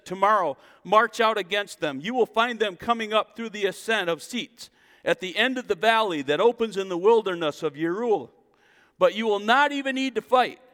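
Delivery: a preacher crying out the promise; preaching at full force. Acted. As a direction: proclaim it to the whole church. Preacher reads scripture at full force.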